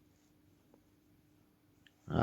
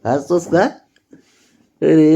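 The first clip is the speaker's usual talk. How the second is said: much higher, louder delighted question; excited; 10 semitones higher, 12 dB louder than usual. Real, not acted. Parent overjoyed as the baby laughs. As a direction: burst out with joy at the laugh.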